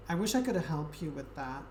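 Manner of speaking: normal talking voice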